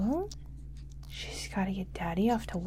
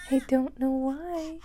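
ordinary delivery

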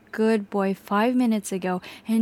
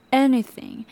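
usual speaking voice

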